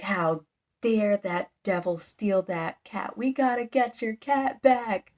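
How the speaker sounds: mock-heroic outrage, slowly drawn out and rising; shifted personality. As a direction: declaim it slowly, building in pitch. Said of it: "shift personalities, and he's like".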